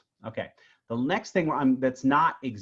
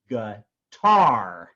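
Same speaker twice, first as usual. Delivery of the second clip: "g, tar" split, short g, then a loud, long TAR; stress on second syllable; 7 dB louder than usual. Shown as a demonstration. Teacher shows backing the word up, "g, tar" with loud accent on tar. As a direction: hold back on "g", punch "tar" hard. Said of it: "tar is the accent".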